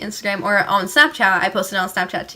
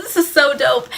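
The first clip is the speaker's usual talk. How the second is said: higher-pitched imitation of a thrilled friend; excited boast; 4 semitones higher, 3 dB louder than usual. Friend filming in the back of cop car, excited. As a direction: play up the friend's mock excitement.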